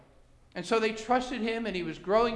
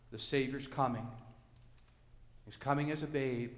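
low, soft, unhurried preaching; hushed reverence; 5 semitones lower, 7 dB quieter than usual. Real, not acted. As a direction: drop to a reverent hush over the promise. Preacher turns reverent about Christ.

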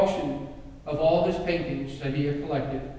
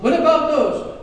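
high, loud, fast complaint; grousing outcry; 9 semitones higher, 8 dB louder than usual. Acted. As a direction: protest the end of the auction. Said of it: "groused and grumbled".

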